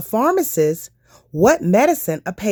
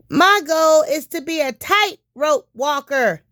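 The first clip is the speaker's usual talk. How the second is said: put-on higher, proud little boy; child's voice; 9 semitones higher than usual. Nick telling the doctor his goal, higher child voice. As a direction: play Nick, a boy sharing his dream.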